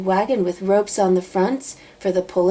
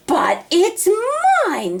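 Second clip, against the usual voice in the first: screamed; 12 semitones higher, 3 dB louder, slower than usual. A narrator's reading. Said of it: "screamed the bird".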